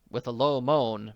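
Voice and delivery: usual speaking voice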